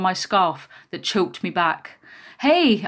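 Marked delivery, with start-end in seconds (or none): 2.4-2.9 high, loud cry of protest. A narrator's reading. Child choked back by the scarf, protesting. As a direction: call out sharply, raised pitch. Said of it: "I protested".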